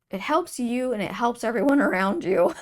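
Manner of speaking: loud, lifting into a high laugh; breaking into laughter. Real, not acted. Reader telling viewers hope helps everyone, bursting out laughing. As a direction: let a real laugh take over the words.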